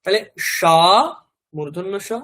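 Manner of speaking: ordinary talk, nothing marked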